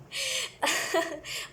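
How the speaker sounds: high-pitched burst of laughter; real amused laugh. Real, not acted. Reader finds people's behaviour ridiculous, amused high laugh. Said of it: "मुझे literally बड़ी हंसी आ रही है".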